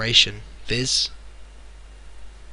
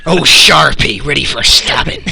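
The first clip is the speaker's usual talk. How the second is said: menacing; 3 semitones higher, 12 dB louder than usual. Acted. Naming knives menacingly.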